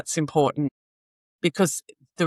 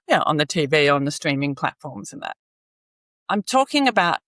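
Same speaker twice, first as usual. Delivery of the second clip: ordinary talk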